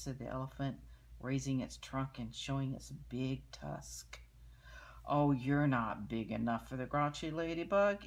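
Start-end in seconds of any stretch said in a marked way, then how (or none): 5.0-8.0 loud scornful taunt; ladybug voice. Acted. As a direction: sneer it down at him. Grouchy ladybug taunts elephant.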